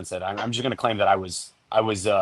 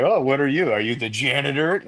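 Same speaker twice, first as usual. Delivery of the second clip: higher, hammed-up clueless question; lightly put-on dopey inquiry; mock cluelessness; 4 semitones higher than usual. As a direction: play yourself as the oblivious fool. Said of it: "their running joke is that I'm like".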